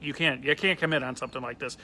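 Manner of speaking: ordinary delivery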